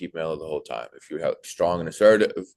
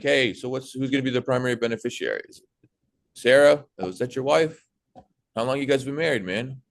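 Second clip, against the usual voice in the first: higher, upbeat, personal small talk; friendly chatty; 6 semitones higher than usual. Shown as a demonstration. Sales trainer showing the right way to ask a client, friendly and chatty. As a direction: chat him up like a buddy. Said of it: "But if I'm like".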